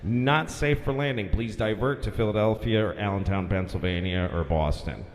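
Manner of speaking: low, steady official radio announcement; lightly voiced controller; tower announcement. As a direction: read it like a flat airport notice. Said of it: "the LaGuardia guy comes".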